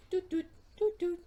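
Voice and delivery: sing-song high doots; bouncy little doot doot; playful high doot sounds. Pretend.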